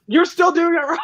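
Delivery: hammed-up, high-pitched nagging; mock nagging advisor. Repeats the nagging advisor bit.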